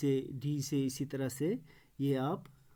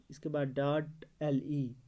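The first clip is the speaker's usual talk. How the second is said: usual speaking voice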